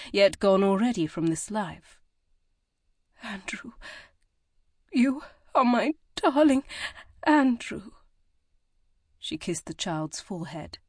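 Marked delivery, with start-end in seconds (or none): 3.2-7.9 a mother's heartbroken farewell; tender grief. A narrator's reading. Mother names her dead son.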